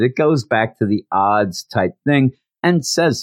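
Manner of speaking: usual speaking voice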